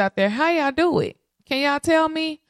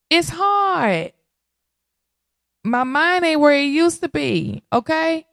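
exasperated; 8 semitones higher than usual. Real, not acted. Overwhelmed new mother venting.

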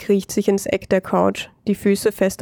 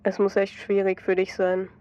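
soft, much quieter sympathy; understanding tone; 7 dB quieter than usual. A narrator's reading. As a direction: gently comfort his ex-wife. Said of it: "in verständnisvollem Ton". (verständnisvoll und deutlich leiser)

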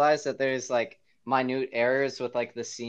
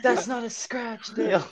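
Kyle's mom; 9 semitones higher than usual. Retold or imitated. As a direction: correct him like an appalled cartoon mother. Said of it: "turned into Kyle's mom from South Park".

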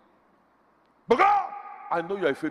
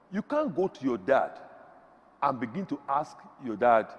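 ordinary delivery

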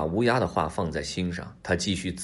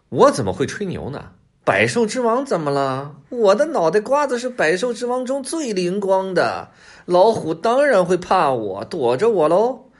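cocky storybook fox, loud and high; stubborn boastful fox; 10 semitones higher, 6 dB louder than usual. A narrator's reading. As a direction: puff yourself up and insist, pitched high. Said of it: "狐狸嘴硬地说".

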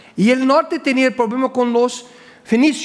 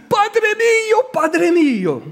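high, drawn-out mock wail; imitated crying plea; 11 semitones higher, slower than usual. King weeping before the sick prophet Eliseo, imitated tearful wail. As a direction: cry out like a tearful king, voice pitched way up. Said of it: "él empieza a llorar y dijo".

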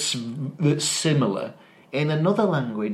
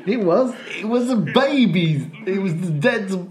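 higher and louder, laughing through the words; 7 semitones higher, 3 dB louder than usual. Real, not acted. Insisting to a friend it was German, laughing. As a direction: giggle while defending the joke.

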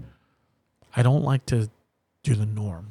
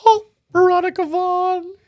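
Billy Madison voice; 8 dB louder than usual. Retold or imitated. Quoting Billy Madison line.

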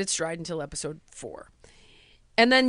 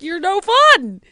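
mock accusation; 8 semitones higher, 8 dB louder, slower than usual. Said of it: "the network was like".

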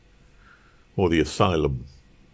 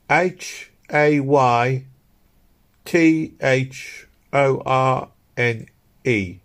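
slow letter-by-letter spelling, higher voice; 6 semitones higher, much slower than usual. A narrator's reading. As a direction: spell it out with long gaps between letters. Woman spells her name out.

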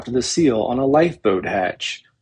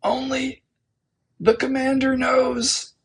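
much higher, strained, choked voice; choked whisper; 13 semitones higher than usual. A narrator's reading. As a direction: squeeze it out past a crushed throat. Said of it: "choked, whisper".

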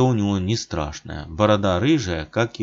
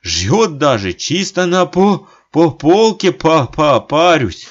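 дрожа от холода; 8 semitones higher, 8 dB louder, slower than usual. A narrator's reading. Стучит зубами от холода.

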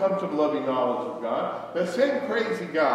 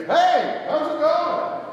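much higher and louder, bright friendly hello; cheery greeting; 12 semitones higher, 6 dB louder, much slower than usual. Retold or imitated. Preacher greeting a stranger at the gas station, beaming high hello. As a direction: call out hi to a stranger at the pump.

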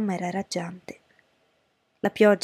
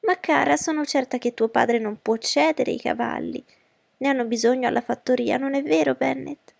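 high, persistent mother's voice; fussy mother, coaxing; 5 semitones higher than usual. Acted. Mother appealing to her husband about the horses, high and coaxing. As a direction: coax in a raised pitch, seeking agreement. Voices Mrs. Bennet in the story.

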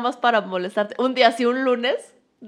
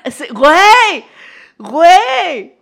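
eager acted agreement; 7 semitones higher, 12 dB louder, much slower than usual.

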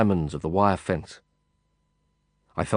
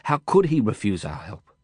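usual speaking voice

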